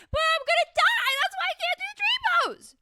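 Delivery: very high, loud hammed-up whine; mock crying wail. Answering her inner critic in yoga class, mock crying wail. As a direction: wail it like a melodramatic breakdown. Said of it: "always feel like I'm gonna cry".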